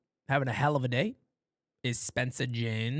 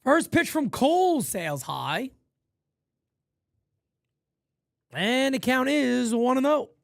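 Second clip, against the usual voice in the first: raised broadcaster call of the at-bat; play-by-play; 10 semitones higher, 5 dB louder than usual. Acted. Streamer calling his baseball game, raised announcer voice.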